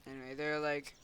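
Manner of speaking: ordinary delivery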